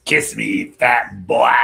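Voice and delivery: loud, taunting clown voice; scary movie clown voice. Acted. Quoting his favorite clown line, loud taunt. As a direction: hit the last word loud as a creepy clown. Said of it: "I love the way he said that".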